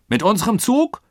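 high, loud incredulous question; ungläubig. A narrator's reading. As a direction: ask in disbelief, rising sharply at the end. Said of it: "fragte ungläubig der Oberst". (ungläubige, hohe Frage)

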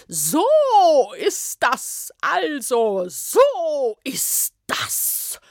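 high, slow, scolding bureaucrat; snorting official. Acted. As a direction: snort it out indignantly. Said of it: "schnaubte diese auch augenblicklich los". (empörte Beamtin schnaubt Smyrna an, langsam und hoch)

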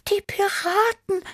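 very high, startled kitten squeak; frightened meow. A narrator's reading. Tibi reacts to the vanished lake, frightened meow. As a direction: mew it in shock, high-pitched. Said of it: "miaute Tibi erschrocken".

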